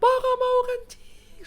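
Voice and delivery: put-on very high-pitched, drawn-out child's yell. Little Fiona tells her parents she must pee, yelling. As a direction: holler it like a toddler the whole village can hear. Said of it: "Sudah dia teriak-teriak".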